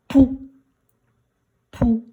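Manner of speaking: high, loud, isolated "pou"; dictation emphasis. Shown as a demonstration. Teacher dictates a syllable to pupils, emphatic.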